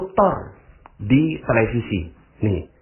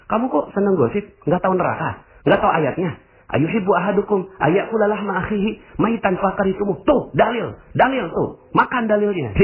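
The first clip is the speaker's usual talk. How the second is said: high, preachy reproach; self-righteous scolding; 8 semitones higher than usual. Pretend. Judgmental believer scolds a gossiper with Quran verses, high and smug.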